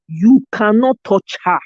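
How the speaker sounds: normal talking voice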